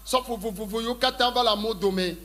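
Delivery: ordinary talk, nothing marked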